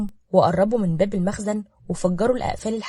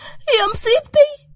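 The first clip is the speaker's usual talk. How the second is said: long, very high wail of disaster; high-pitched lamenting scream; 9 dB louder, much slower than usual. A narrator's reading. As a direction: shriek it out, drawn out and loud. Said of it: "شهقت وضربت على قلبها وهي بتصرخ".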